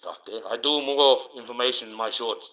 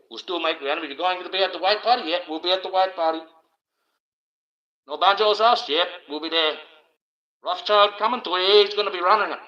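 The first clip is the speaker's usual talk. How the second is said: higher, livelier mock conversation; pitched-up, loud back-and-forth banter; mock chummy plotters; 7 semitones higher, 5 dB louder than usual. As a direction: play both sides of an eager invitation. Acting out leaders planning a party.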